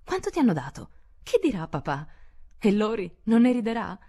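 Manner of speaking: high, rushed eager questions; breathless questions. A narrator's reading. The family crowds Joe, asking eagerly. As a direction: fire them off in one breath. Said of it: "chiese d'un fiato solo".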